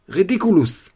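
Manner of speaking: high, loud incantation; shouted spell. Acted. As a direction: snap the spell out. Lupin casts spell.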